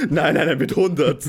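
laughing playful protest. Real, not acted. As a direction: laugh while correcting him. Teasing correction, laughing.

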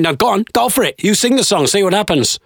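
high, lightly voiced heckler egging on; imitated heckler. Heckler dares comedian to sing, high goading voice. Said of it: "He's going".

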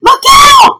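very high, loud shriek; yelled warning. A narrator's reading. Two boys warning their teacher, shrill yell. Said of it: "yelled Kevin's B and K".

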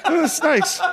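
delighted Brody. Retold or imitated. Podcaster acting out Brody's pleased greeting to the car, high and giggly. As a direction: play the naive professor, pleased as punch, laughing through it. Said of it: "Brody's like".

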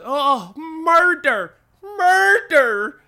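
high, loud shrieking of murder; hammed-up high panicked shriek; mock scream. Crowd screaming at corpse.

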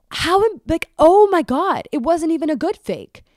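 high, loud comic dismay; mortified exclamation. Retold or imitated. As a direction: wail it like it's a social disaster. Reliving horror at the fake bag.

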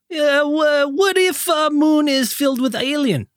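put-on high, goofy Russian accent; mock stoned Soviet scientist. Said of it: "he's like".

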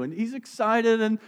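ordinary talk

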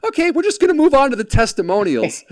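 high-pitched mock showman; imitating a chipper TV pitch; pitchman. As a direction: gloss over disaster like an infomercial host. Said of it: "pitch guy".

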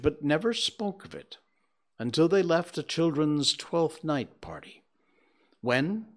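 expressive storybook reading voice; story narration. A narrator's reading. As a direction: tell it like a fireside tale. Reads Dickens narration aloud.

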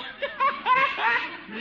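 high burst of laughter; mocking laugh. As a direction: laugh helplessly at the poor fellow. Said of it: "stop laughing".

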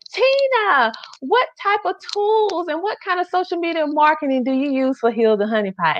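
high mimicking voice of an eager viewer; viewers asking. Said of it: "you have been asking me for months".

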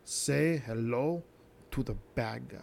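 slow, low growled wrestler catchphrase; Razor Ramon catchphrase. Retold or imitated. Quoting Scott Hall to the viewers, slow and low. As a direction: drag out the wrestler's line slowly. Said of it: "Scott Hall or Razor Ramon".

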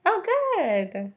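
high, drawn-out approval; cheery approval. Real, not acted. Cohost reacting to news of crying, cheery approval. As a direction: draw it out high, sing-song.

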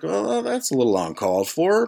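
high-pitched, hammed-up objection; mock affronted. Storyteller answering the host's insults, mock affronted. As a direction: protest in a high, put-upon voice.

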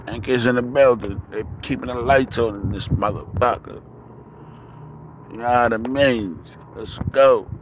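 loud, high podcast hype; hype sign-off. Real, not acted. Podcast host hyping his sponsors and show, loud and high. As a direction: pump up the listeners.

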